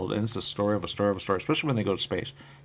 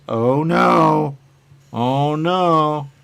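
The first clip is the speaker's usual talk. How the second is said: mock alarm; 6 semitones higher, 6 dB louder, much slower than usual. Mock dismay at the neighbours' refusal.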